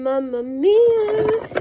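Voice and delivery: high, drawn-out Mamma Mia; playful exclamation. Real, not acted. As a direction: sing it out, amused and slow. Excited exclamation over product.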